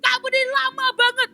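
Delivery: high, loud, fast whiny scolding; impatient scolding. Acted. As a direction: nag the late angel. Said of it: "dia bilang".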